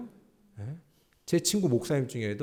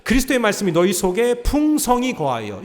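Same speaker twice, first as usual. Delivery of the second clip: loud, raised reading of the verse; scripture read aloud; 9 semitones higher, 11 dB louder than usual. Acted. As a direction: lead the congregation in reading. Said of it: "시작". (회중과 함께 크게 읽는 성경 봉독)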